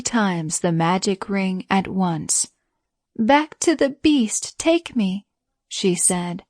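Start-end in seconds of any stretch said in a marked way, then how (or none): 3.2-5.3 high urgent command; urgent plea. A narrator's reading. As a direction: call it out urgently. Beauty urgently commanding the ring.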